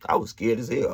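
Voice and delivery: usual speaking voice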